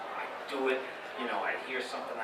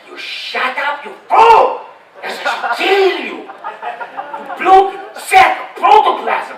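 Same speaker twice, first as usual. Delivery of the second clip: very high, very loud, slow furious cartoon scream; unhinged chihuahua screaming with a laugh in it; Ren screaming; 20 dB louder, much slower than usual. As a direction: scream till the veins pop out of your neck. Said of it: "Ren was like".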